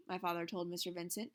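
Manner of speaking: ordinary delivery, nothing marked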